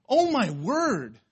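drawn-out, high, loud exasperation; exasperated. Real, not acted. Preacher fed up with people offended by everything, exasperated. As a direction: stretch it out in disbelief.

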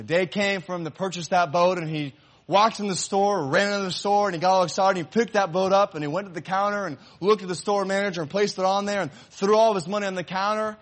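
high, loud, eager narration; excited storytelling. A narrator's reading. Preacher telling of the boy rushing in to buy the boat, eager. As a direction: tell it with rising joy.

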